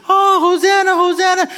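preacher voicing the crowd hailing Jesus; crowd's joyful cry. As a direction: cry out like a crowd waving palms. Crowd praising Jesus with palms.

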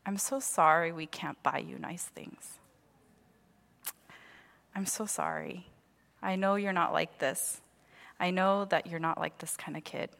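soft, gentle, hushed comfort; tender apology. Retold or imitated. Mom on the bed comforting her daughter, soft and gentle. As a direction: ease it out like a mother at the bedside. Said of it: "she sits on my bed and she goes".

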